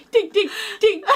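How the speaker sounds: imitated tinking. Owner mimicking the cat batting the lamp chain, laughing tinks.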